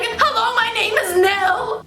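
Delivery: very high, loud, rehearsed greeting; little sister performing her greeting for guests; forced-cheery kid. Retold or imitated. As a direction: recite it like a drilled child. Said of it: "Nil would be like".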